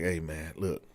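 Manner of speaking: hushed aside to the captain; soft, fast confidential aside; sergeant pulling him aside. Retold or imitated. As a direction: keep it low, just between us. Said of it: "This the sergeant talking".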